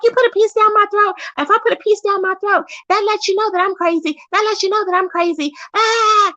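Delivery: high-pitched, frantic rant in imitation; imitated high, repetitive ranting; mock yelling. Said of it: "She kept yelling about a piece".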